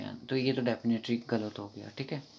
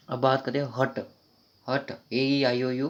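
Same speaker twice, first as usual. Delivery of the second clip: usual speaking voice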